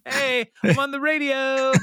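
high, gleeful squeal of delight; like a kid who just heard himself on the radio; thrilled Andy. Retold or imitated.

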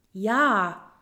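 loud, impatient yes; exasperated, emphatic answer. A narrator's reading. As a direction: push it out impatiently. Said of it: "sagte Roswitha ungeduldig".

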